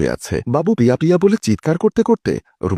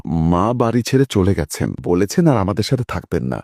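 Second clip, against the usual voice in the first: usual speaking voice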